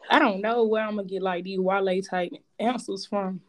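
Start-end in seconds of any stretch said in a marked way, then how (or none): none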